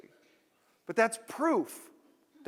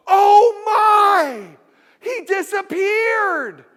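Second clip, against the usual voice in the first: very high, loud hammed-up gasping shout; fake shocked shout; 14 semitones higher, 14 dB louder, much slower than usual. Actors faking shock at the vanished man, screaming. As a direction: overact the shock as a planted actor. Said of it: "all of the actors know to do it".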